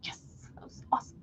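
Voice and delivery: fast, soft whisper. Retold or imitated. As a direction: breathe it out under the breath. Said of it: "I was like".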